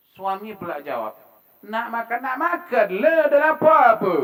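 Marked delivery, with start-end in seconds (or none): 1.6-4.1 high, loud irritated retort; acted snappish husband, building to a shout; bad, rude intonation. Shown as a demonstration. Husband answering wife's offer of food, rudely shouting. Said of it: "Tapi intonasi itu tak bagus".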